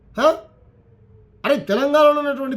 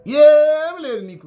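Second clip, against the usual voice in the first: slow, loud, higher angry shout; angry outburst; 5 semitones higher, 6 dB louder, much slower than usual. Real, not acted. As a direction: spit the words out in disgust. Angry political rant. (కోపంతో గట్టిగా, నెమ్మదిగా అరుపు)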